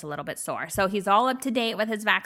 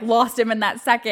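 ordinary talk

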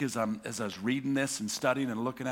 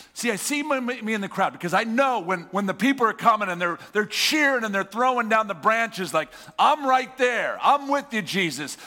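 excited crowd; 5 semitones higher, 4 dB louder than usual. Real, not acted. Preacher cheering in the crowd.

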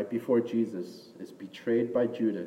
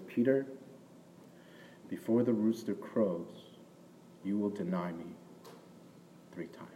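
a gentle master quietly foretelling; calm and quiet, fading nearly to a whisper; 7 dB quieter than usual. Retold or imitated. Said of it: "Jesus says to him very calmly".

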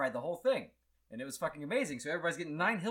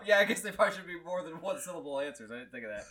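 ordinary talk, nothing marked

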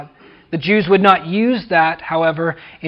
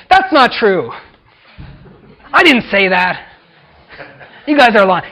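mock protest; 2 semitones higher, 4 dB louder than usual. Preacher voicing an imagined Jesus denying accusers, indignant. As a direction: protest the lies.

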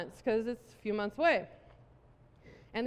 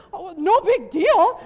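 indignant echo; 7 semitones higher, 13 dB louder, slower than usual. Retold or imitated. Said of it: "You know".

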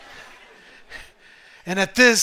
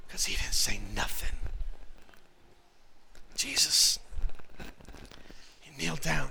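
whisper; 6 semitones lower, 10 dB quieter than usual. Real, not acted. Dramatic hush about Jesus' silence.

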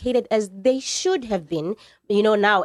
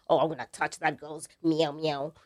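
low, soft mock leering voice; imitating bar lech; 6 semitones lower, 7 dB quieter than usual. Speaker mimicking men sitting in bars, low hushed leer. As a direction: play a man in a bar talking low to his mates.

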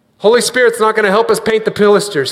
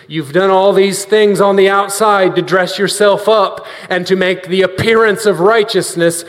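ordinary talk, nothing marked

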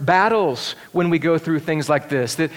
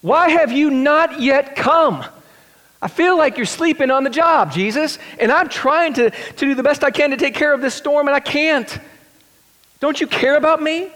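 high, pleading inner cry to Jesus; anxious plea; 10 semitones higher, 3 dB louder than usual. Retold or imitated. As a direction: plead like someone sinking. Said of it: "and you think".

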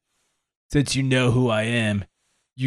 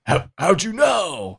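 high, sudden gasp of disbelief; startled character; 10 semitones higher than usual. Acted. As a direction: caught out, jolting up in alarm. Character caught off guard, revealed.

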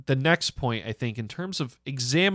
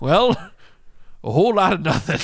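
put-on loud, higher-pitched old-timer voice; hammed-up elder owning up, pitched up; elderly person; 6 semitones higher, 6 dB louder, faster than usual. Old survivor admitting to doing nothing, mock elderly voice. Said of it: "I can't even do my old elderly accent".

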